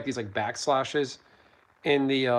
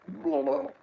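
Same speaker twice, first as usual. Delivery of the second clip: high, gurgled underwater blub; shouting underwater; 14 semitones higher than usual. Shown as a demonstration. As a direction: call out as if underwater. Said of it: "that one sounded good".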